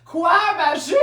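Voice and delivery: loud, high startled question; hammed-up shock at a discovery; re-enacted disbelief. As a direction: blurt it in mock alarm. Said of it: "je suis comme".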